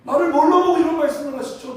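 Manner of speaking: acted-out indignant protest, high-pitched; wounded pride. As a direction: insist on your worth, offended. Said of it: "너무 서운한 거야". (자존심 상해 서운하게 항의하듯)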